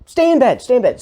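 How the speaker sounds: high-pitched hurried reassurance, acted out; hasty reassurance. Husband tells wife to stay in bed, high reassurance. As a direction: imitate yourself placating someone from the doorway.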